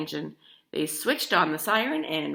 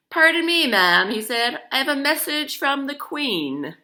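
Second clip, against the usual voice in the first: loud, higher herald voice; town crier; 8 semitones higher, 7 dB louder than usual. A narrator's reading.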